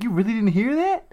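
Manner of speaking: high, rising disbelief; incredulous question. Retold or imitated. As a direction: imitate a man who can't believe it. Electrician's disbelief, acted out.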